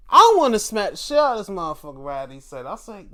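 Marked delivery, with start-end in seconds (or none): 0.1-2.0 loud, fast retold rage; spitting out the threat in fast fury; mock fury. Retelling the urge to hit the ref, fast loud mock rage. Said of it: "I'm like".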